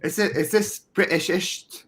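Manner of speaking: high, questioning mock-British accent; put-on British accent. Shown as a demonstration. Podcaster doubting his own accent, in high mock British. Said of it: "So this is my British accent".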